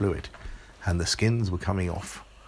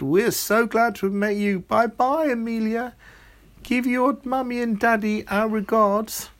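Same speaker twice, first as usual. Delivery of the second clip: much higher and louder, chirpy farewell; cheery imps; 14 semitones higher, 6 dB louder than usual. Acted. Grape imps waving Amelia off, high and loud. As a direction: sing out goodbye like little imps.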